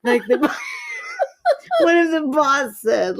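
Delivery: words squeezed through laughter; laughing till tears come; laughing hard. Real, not acted. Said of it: "you're making me cry".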